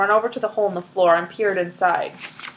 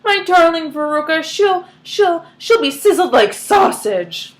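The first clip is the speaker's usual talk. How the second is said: higher, louder anguished cry; horrified mother; 6 semitones higher, 5 dB louder than usual. Acted. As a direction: wail it in horror.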